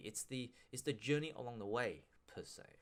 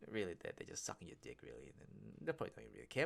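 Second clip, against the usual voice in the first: low snide aside; 3 semitones lower, 8 dB quieter than usual. Acted. Snide aside about mates.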